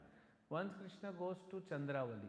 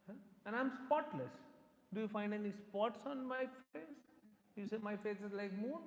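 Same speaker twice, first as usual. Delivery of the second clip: upset retort; 7 semitones higher than usual. Retold or imitated. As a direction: hurl it back at him, offended. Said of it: "very upset".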